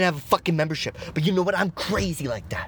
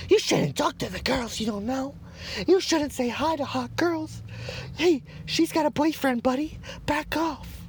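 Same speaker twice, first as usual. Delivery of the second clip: much higher, whiny scold voice; mocking moralizer; 12 semitones higher, 3 dB quieter than usual. Mocking the moral scolds who warn him off girls, whiny high nag. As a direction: mimic the preachy busybody. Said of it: "People wanna play the moral high card".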